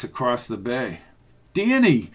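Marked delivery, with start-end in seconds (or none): 1.5-2.1 high call of the name; shouting. A narrator's reading. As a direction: shout his name urgently. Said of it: "she shouted".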